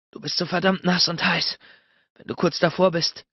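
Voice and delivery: lightly panted, intimate talk. A narrator's reading. Narrator answering his lover's plea, panting. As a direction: say it as if short of breath. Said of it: "keuchte ich".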